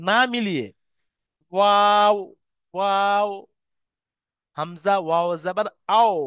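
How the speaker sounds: open-lipped Arabic waw; lips apart. Shown as a demonstration. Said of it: "দুটো ঠোঁট মিলছে না কিন্তু".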